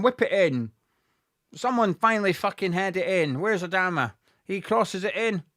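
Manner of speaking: normal talking voice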